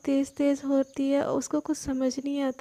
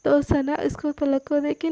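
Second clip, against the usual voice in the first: ordinary delivery, nothing marked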